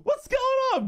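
very high, loud squealed greeting; exuberant greeting. Pretend. Streamer greeting viewers early in the morning, exuberant.

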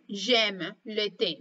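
slow, careful j'aime le thé; teacher demo, very slow; slow model phrase. Shown as a demonstration. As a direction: spell it out gently for learners. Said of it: "como por ejemplo".

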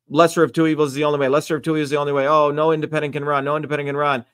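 fast, mocking recitation of worn-out slogans; rapid parroting. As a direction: rattle off slogans on repeat. Voicing endlessly repeated political slogans.

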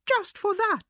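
high-pitched indignant mouse; storming. A narrator's reading. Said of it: "stormed the mouse".

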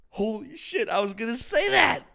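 gasping, high and loud; excited surprise. Real, not acted. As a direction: blurt it out in sudden delight. Gasps at shared Sean Bean idea.

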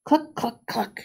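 secretive chicken clucking; scheming hens whispering clucks; whispered clucks. A narrator's reading. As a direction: cluck it like a conspiring hen. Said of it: "they whispered".

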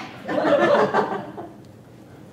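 a loud, high evil laugh; wicked laugh. Acted. Widow laughing at her stabbing story. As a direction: laugh at your own lie.

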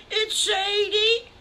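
high, loud, wailing sob through the words; crying child. Acted. Sally Skunk cries to Farmer Brown about her lost sister, high wailing sob. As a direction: blubber it out like a lost little one.

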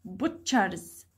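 "Butchers" low, with stress on BUT; low-pitched "Butchers", weight on BUT; stressed first syllable. Shown as a demonstration.

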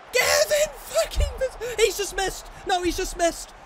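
high, loud yell at the near miss; shocked shout. Real, not acted. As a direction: shout as the shot flies past.

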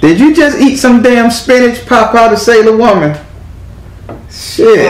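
playful high-pitched incredulity; mock amazement. Real, not acted. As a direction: tease her in a high, astonished voice. Mock amazement at client's strength.

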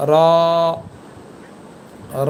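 র as a long, full-mouthed "raw"; tongue tip to palate, heavy held র; thick letter sound. Shown as a demonstration. Teacher showing the thick letter, long rounded র. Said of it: "র হরফটি একটু মোটা হয়".

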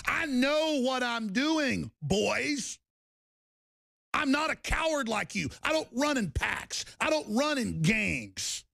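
high, drawn-out defiance; emphatic, indignant boasting; defiant rant. Real, not acted. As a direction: stand up to bullies, stretching the words.

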